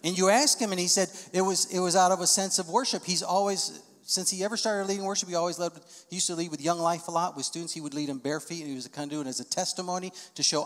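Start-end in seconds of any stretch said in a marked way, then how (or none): none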